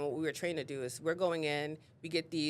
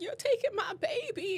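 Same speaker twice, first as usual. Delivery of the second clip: high, pleading wail of a crying grandmother. Retold or imitated. Grandmother pleading with the social worker, tearful and high. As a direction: beg through tears not to lose the child. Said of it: "And she started crying".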